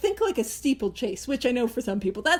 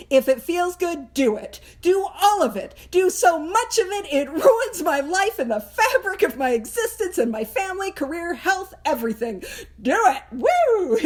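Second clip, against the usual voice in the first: reckless motto; 4 semitones higher than usual. Acted. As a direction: build into a whooping cry of excess. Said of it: "what is sort of the alcoholic motto?".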